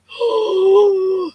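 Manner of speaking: high surprised gasp; mock-shocked gasp; sharp gasp. Real, not acted. Shocked reaction to RV story.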